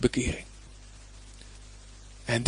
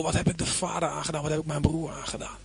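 softer, remorseful inner voice; 5 dB quieter, faster than usual. Acted. A repentant sinner asking what he did to his father, softer. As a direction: ask it as someone truly sorry.

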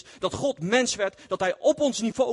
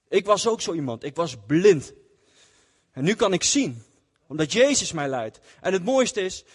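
ordinary delivery

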